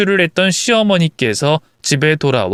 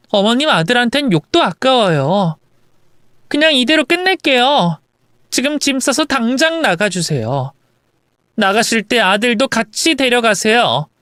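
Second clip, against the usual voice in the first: lightly acted dismissive daughter-in-law; defiant wife; 8 semitones higher than usual. Wife ordering mother-in-law and son out, defiant. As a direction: send her away with finality.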